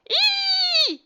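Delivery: very high, loud yelp of pain; yell of pain. Acted. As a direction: shriek as the cat claws his face. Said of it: "yelled Lefty".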